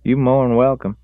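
put-on high, loud young country voice; country boy voice. A narrator's reading.

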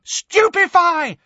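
very high, loud drawn-out scream; screamed spell. A narrator's reading. Hermione stunning the attacker as she catches up, screamed. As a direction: scream the incantation, long and high. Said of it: "screamed Hermione".

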